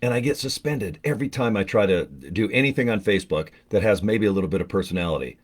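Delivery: usual speaking voice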